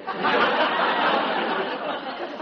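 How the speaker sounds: breathy, airless laugh at the joke; amused laugh. Acted. Peg laughs at fertilizer joke.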